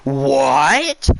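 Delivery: a loud furious roar. A narrator's reading. Witch Queen roaring at the feasting animals, furious. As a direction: bellow it at the animals. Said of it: "roared the rich".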